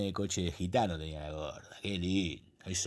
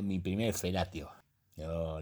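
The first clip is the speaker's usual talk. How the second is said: ordinary delivery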